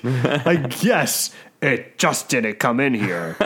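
slow, lightly gruff janitor; a hint of a slow menacing janitor. Narrator voicing the janitor for the players, slowly. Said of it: "I love this janitor voice".

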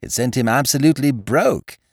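high-pitched, excited character voice; high, eager gentleman storyteller; Looney, cheerful friend. A narrator's reading.

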